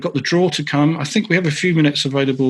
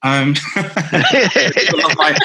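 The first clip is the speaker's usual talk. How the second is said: laughing; 3 semitones higher, much slower than usual. Real, not acted. Laughs at being praised.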